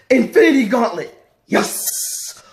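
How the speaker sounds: high, loud, excited exclamation; hyped announcement. Real, not acted. Host hyping the comic for viewers, excited announcement. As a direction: shout the title, then a drawn-out yes.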